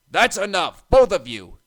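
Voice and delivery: loud sharp rebuke; snapping. A narrator's reading. Cranky scolding the twins, sharp snap. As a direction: shut them up at once. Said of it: "snapped Cranky".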